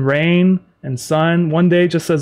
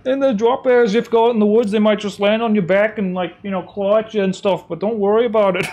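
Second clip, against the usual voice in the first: high, louder hammed-up Australian accent; mock Australian accent; 7 semitones higher, 4 dB louder than usual. Streamer spoofing Australian tourism warnings, hammed-up Australian accent. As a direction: cheerfully warn about drop bears in a fake Aussie accent. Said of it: "I don't know what this accent is".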